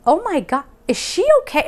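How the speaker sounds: high, worried man's voice; concerned stranger. Retold or imitated. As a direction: mimic his alarmed concern. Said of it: "he looked at me and he goes".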